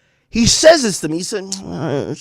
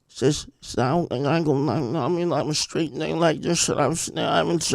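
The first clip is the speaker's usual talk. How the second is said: mumbled [name]; 3 semitones lower, 8 dB quieter than usual. Retold or imitated. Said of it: "he cannot pronounce them".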